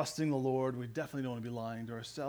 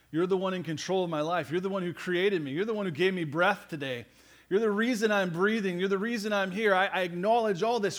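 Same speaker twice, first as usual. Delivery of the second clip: raised, building declaration; fervent prayer; 4 semitones higher, 6 dB louder than usual. Acted. Believer acknowledging God's authority over their life, fervent prayer. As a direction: build louder and higher with each acknowledgment.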